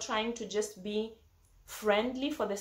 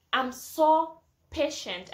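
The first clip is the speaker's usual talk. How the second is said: proud emphasis; 5 semitones higher, 5 dB louder, slower than usual. Real, not acted. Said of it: "proud self-description".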